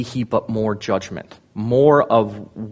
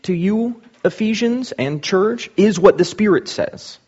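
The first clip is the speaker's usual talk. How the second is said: much higher, slow, lightly voiced Christ; voicing Christ; 10 semitones higher, 4 dB louder than usual. Christ speaking to the Ephesian church, higher and slow. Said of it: "Christ is saying".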